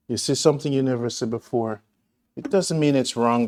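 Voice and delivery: ordinary talk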